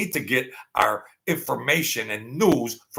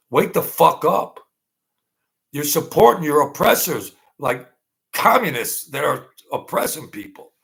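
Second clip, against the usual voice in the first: angry outburst; 4 semitones higher, 6 dB louder than usual. Real, not acted. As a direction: shake the viewers awake, furious. Angry call to viewers.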